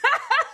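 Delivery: short, high, loud giggle; quick burst of self-amused laughter; real laugh. Real, not acted. Woman deciding to do both matchas, laughing.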